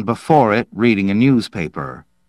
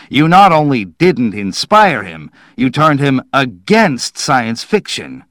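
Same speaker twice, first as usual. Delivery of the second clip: raised, higher accusing voice; appalled scolding; 5 semitones higher, 5 dB louder than usual. A narrator's reading. Manville scolding Slade for the failure, appalled. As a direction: accuse with mounting outrage.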